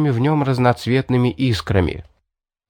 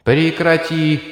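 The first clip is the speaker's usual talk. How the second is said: booming thunder voice; 7 semitones higher, slower than usual. Acted. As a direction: thunder the order down. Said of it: "прогремел голос богини".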